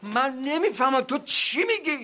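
exasperated. Acted. Father exasperated with demanding son.

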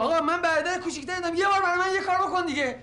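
high, whiny plea to his big brother; pleading. Acted. Younger brother begs his older brother, high and whiny. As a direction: beg like the little brother.

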